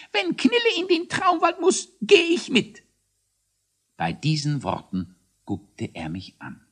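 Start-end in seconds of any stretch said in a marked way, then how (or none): 0.1-2.8 very high hare voice, declaring; squeaky announcement. A narrator's reading. Hare vows to join his banished friend, squeakily proclaiming. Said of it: "und verkündete".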